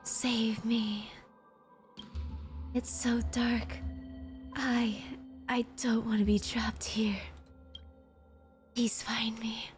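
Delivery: faint ghostly whisper, pleading; unearthly voice. Acted. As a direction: whisper like an echo from the dark. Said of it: "you hear a ghostly voice".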